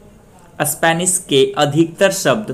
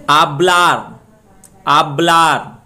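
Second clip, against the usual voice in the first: आबलार with a loud, held blur; stress on last syllable; 2 semitones higher, 5 dB louder, much slower than usual. Shown as a demonstration. Teacher recaps the rule, stressing blur in आबलार. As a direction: punch and stretch blur in आबलार. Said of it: "last syllable पे डालें".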